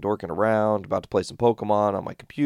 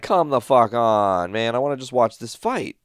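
exasperated; 4 semitones higher, 5 dB louder than usual. Retold or imitated. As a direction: groan it out in frustration at the screen. Frustrated at frozen fight stream.

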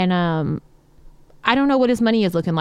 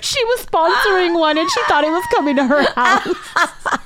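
laughing; 7 semitones higher than usual. Real, not acted. Laughing at the tweet.

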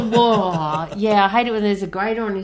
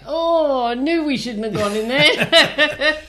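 dismayed worker; 6 semitones higher, 4 dB louder, slower than usual. Retold or imitated.

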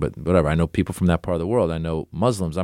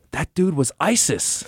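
put-on a higher kid voice, hushed in shock; awed little kid; 7 semitones higher than usual. Wisconsin kid whispers his shock to the adult. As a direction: play the stunned six-year-old. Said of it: "He goes, gasps".

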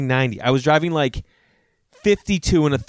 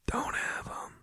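whisper; 16 dB quieter, slower than usual. Acted. Advice to not have kids.

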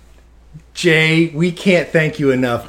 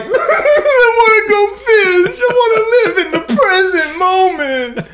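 high, laughing mock-sob of being moved; gushing through tears; 14 semitones higher than usual. Host retelling his reaction to the fish story, high laughing mock-sob. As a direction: gush like the story made you weep, laughing through it. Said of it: "I'm like".

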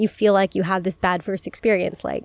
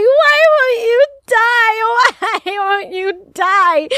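very high, loud, slow shriek breaking into a laugh; drawn-out panicked shriek, laughing; screaming at the wasp; 11 semitones higher, 5 dB louder, much slower than usual. Retold or imitated. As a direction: scream it at the bug that won't die. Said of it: "I am screaming at the top of my lungs".

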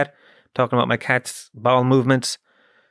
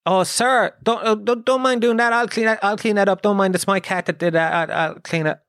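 high, fussy, apologetic good-neighbour voice; much higher mock-courteous offer to clean up; overly polite neighbour; 7 semitones higher than usual. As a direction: fall over yourself being polite to the old man. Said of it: "Any good person would probably go".

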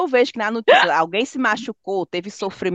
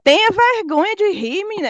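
mock scolding; 10 semitones higher than usual. Scolding a friend who laughs at the story, in a high mock tone.

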